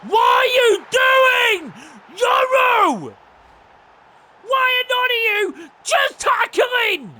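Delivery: high, loud shout at teammates; annoyed yelling. Real, not acted. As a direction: bellow at your defenders in frustration. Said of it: "I'm getting annoyed now".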